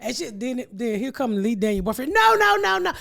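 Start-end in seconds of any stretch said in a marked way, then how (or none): 2.1-2.9 loud, high string of protests; emphatic refusal. Retold or imitated. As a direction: cut in with a loud, high objection. Acted refusal in makeup quarrel.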